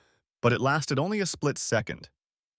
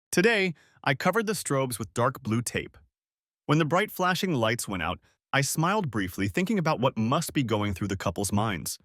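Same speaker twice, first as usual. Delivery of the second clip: plain reading voice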